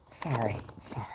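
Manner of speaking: soft unvoiced whisper; whispered sorry. Real, not acted. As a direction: breathe the apology. Whispered apology to card.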